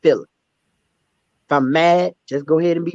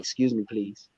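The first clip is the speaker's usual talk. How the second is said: soft polite request; 8 semitones lower, 9 dB quieter than usual. Shown as a demonstration. Showing co-host his new polite way, soft Excuse me, please. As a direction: Excuse me, please. said much lower and softer. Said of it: "I'd be like".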